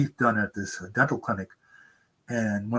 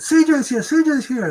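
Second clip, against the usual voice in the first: high, loud excited squealing; 6 dB louder than usual. Retold or imitated. Office girls greeting the author, loud excited squeal. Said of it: "one of the girls".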